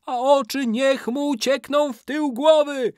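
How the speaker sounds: extremely high, loud wail; ritual curse. A narrator's reading. Old man curses the betrayer's eyes, high wail. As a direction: shriek out the incantation.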